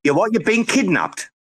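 high-pitched, disbelieving questions; incredulous. Real, not acted. Host asking a caller if he's been kidnapped, incredulous. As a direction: react as if you can't believe it. Reacting to Bruce's odd, noisy call.

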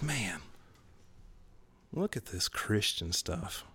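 soft, slowing grumble to himself; disillusioned aside. Retold or imitated. Student walking on past the protesters, quiet disillusioned aside. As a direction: drop the volume, as if walking away unimpressed. Retold disgusted remark walking away.

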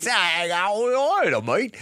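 high, hammed-up Australian-sounding French accent; botched French accent. Host mimicking supposedly French racer, botched accent. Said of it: "That's not a French accent".

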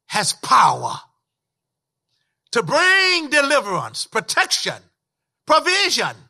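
preacher's chant-like list, climbing in pitch; building sermon litany. Real, not acted.

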